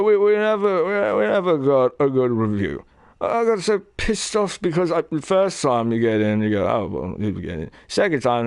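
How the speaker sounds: slurred, wandering rocker voice; British metal singer, rambling rant. Retold or imitated.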